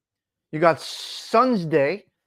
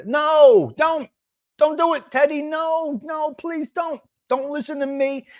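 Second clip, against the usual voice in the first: high pleading; 9 semitones higher, slower than usual. Acted. Pleading not to Google it.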